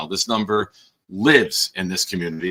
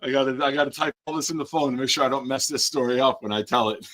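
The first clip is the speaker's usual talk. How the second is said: laughing retelling; 6 semitones higher than usual. Retold or imitated. Dad retelling his joke.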